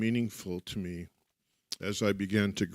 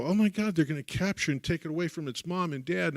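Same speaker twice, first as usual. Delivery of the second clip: put-on childlike panic; 6 semitones higher than usual.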